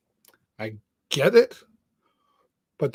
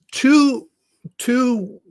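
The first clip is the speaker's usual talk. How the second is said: voice catching; 9 semitones higher than usual. Real, not acted. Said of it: "I'm trying not to get emotional".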